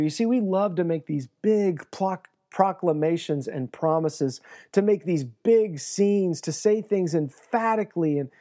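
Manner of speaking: ordinary delivery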